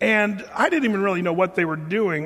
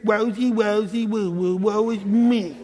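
mock whining; 2 semitones higher, slower than usual. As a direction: ham up a self-pitying king. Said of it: "he's sitting here".

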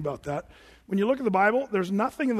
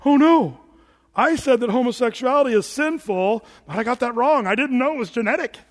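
mock panicked God; 4 semitones higher, 6 dB louder than usual. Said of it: "God's not in heaven going".

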